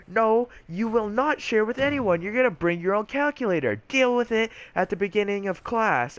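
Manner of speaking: high, emphatic angry outburst; mock screaming teacher. Teacher refusing to let kids share, mock screaming. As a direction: imitate a teacher yelling, voice pitched high. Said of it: "screaming at the top of her lungs".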